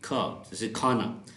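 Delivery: "खा" said with a strong breathy kh sound; aspirated kh. Shown as a demonstration. Teacher showing the aspirated "खा" sound. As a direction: push air on the "kh" of "खा". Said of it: "वो actually खे".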